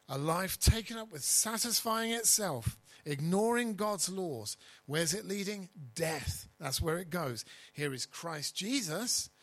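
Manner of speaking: rising pulpit fervour; full-force preaching. Acted. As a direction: preach it out to the congregation. Preacher contrasts death and life.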